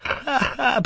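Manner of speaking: high burst of forced laughter; strained high laugh; unnatural laugh. A narrator's reading. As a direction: cackle a little too wildly. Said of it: "amid bursts of unnatural laughter".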